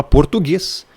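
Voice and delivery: "português" with silent u after the g; everyday "português", the u left mute. Shown as a demonstration. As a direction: say "português" with a plain hard g before e. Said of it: "Só que a gente fala".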